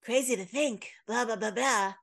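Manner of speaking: lightly mimicked TV narrator; Meredith Grey. Podcaster imitating a drama's opening monologue, light mimicry. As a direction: imitate a soft-spoken monologue voiceover. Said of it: "That's how she talks".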